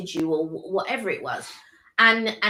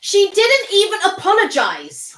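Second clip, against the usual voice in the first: indignant; 11 semitones higher, 7 dB louder than usual. Real, not acted. Indignant objection to host.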